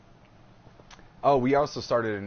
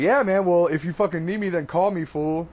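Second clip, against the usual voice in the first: higher, louder mock Mike, cocky; imitating Mike; 6 semitones higher, 5 dB louder than usual. Host mocking what Mike told his coordinator, loud. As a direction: ham up his careless offer. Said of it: "All because he was like".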